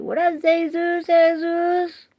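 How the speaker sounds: loud, high "Zay-zoos" for Jesus. Real, not acted. Streamer hails viewer Jesus, name hollered as "Zay-zoos". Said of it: "I say your name like that".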